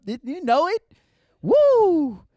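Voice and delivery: high, loud whoop; hype cheer. Real, not acted. Comic pumping up the small crowd, loud whoop. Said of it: "hyping the crowd".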